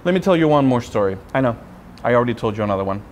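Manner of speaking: normal talking voice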